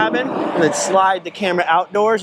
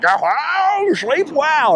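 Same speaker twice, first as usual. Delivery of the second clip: slow, much higher, drawn-out line; over-the-top misquote; 9 semitones higher, much slower than usual. Retold or imitated. Imitating friend's ridiculous take on the movie line, slow and high. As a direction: ham it up like a silly movie quote. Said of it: "so silly and funny and ridiculous and over the top".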